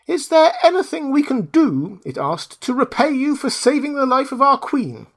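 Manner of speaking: very high mouse voice; big mouse. Acted. Biggest mouse offers to repay the Woodman, high squeak. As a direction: offer eagerly like a grateful mouse.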